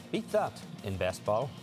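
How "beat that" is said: mock challenge. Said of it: "turn to your partner and say".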